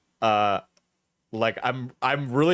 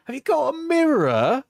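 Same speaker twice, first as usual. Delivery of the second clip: very high, hammed-up British exclamation; put-on accent; 10 semitones higher, 4 dB louder than usual. Reacting to "you are crazy", high theatrical British accent. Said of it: "when I'm doing the accent".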